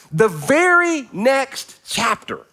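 "very next chapter" drawn out slowly and pitched high; emphatic repeat. Real, not acted. Preacher stressing "very next chapter" to the congregation, slow emphatic repeat. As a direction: stretch "very" and "next" high, word by word.

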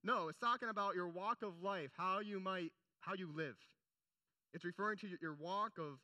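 ordinary talk

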